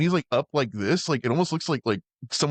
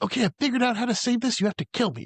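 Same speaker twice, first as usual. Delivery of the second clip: mock wife; 13 semitones higher, faster than usual. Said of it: "his wife's like".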